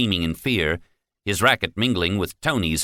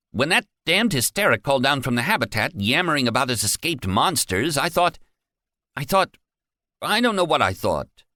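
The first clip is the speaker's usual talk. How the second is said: flustered; 8 semitones higher than usual. A narrator's reading. Guard defensive and shaken.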